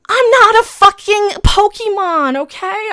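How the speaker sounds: high, loud, slow protest; indignant outburst. Real, not acted. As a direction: stretch it out in loud outrage. Indignant outburst after mocking studios.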